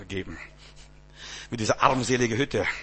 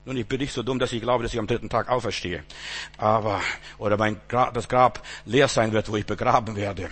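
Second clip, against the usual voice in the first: normal talking voice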